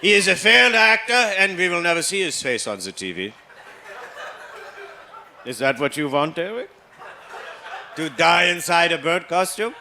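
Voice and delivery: a father deflating his son's dream, mock stern; stern German dad. Father answers about Big Bird.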